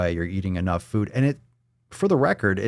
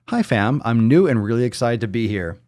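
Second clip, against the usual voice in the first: ordinary talk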